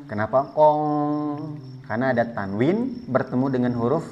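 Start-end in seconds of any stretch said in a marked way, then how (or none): 0.5-1.6 "kong" with a long held nasal hum; ikhfa. Shown as a demonstration. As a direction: sustain the "ng" of "kong" on one pitch. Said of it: "sehingga dibaca ikhfa". ("kong" dengung ikhfa dipanjangkan)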